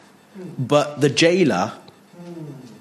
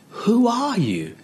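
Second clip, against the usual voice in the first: high swooping, drawn-out challenge; high-pitched, stretched-out disbelief; incredulous question; 11 semitones higher, slower than usual. Acted. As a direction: ask it high, slowly sliding right down. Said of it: "Now, I say it like that".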